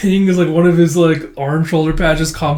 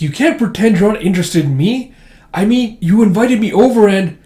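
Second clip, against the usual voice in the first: higher, louder hurt protest; heated pleading; 7 semitones higher, 6 dB louder than usual. Retold or imitated. Rejected Mark argues with the priest, pleading heatedly. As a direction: argue back, voice raised.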